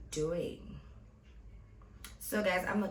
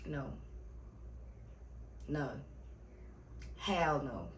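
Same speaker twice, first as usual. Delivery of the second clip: each "No." said flat and firm, then "Hell no." lower and final; emphatic no; 3 semitones lower, 6 dB quieter than usual. Real, not acted. Refusing to date a twenty-one-year-old, stressing no. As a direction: hammer the "no" harder each time. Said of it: "Emphasis on no".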